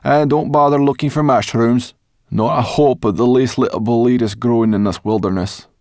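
high, loud grumbling voice; Sorrel, grumpy companion. A narrator's reading. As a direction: warn them off brashly.

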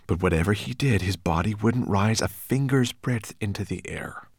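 ordinary delivery, nothing marked

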